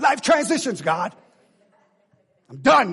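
loud, high acted protest to God; exasperated cry, played big; frustrated outburst. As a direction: re-enact a fed-up tantrum. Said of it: "I was frustrated".

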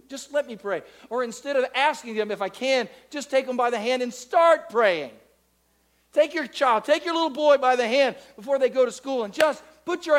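loud, rising preacher's call; fiery preaching. Acted. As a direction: preach it hard to the whole church. Preaching at full force.

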